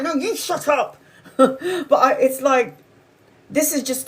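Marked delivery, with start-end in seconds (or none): 0.0-1.0 high-pitched mock comeback; exasperated retort, pitched up. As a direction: fire back at the screaming neighbor. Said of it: "that's what I was saying".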